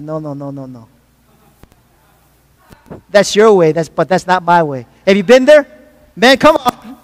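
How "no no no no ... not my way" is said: much softer and lower, a gentle refusal; hushed, lower voice for God turning down your way; God saying no. Acted. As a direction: drop low and quiet, voicing God's firm no. Preacher voicing God's refusal.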